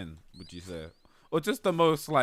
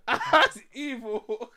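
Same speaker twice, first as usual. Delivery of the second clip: laughing; 7 semitones higher, much slower than usual. Real, not acted.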